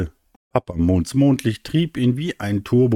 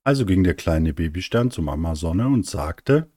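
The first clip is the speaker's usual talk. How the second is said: ordinary delivery, nothing marked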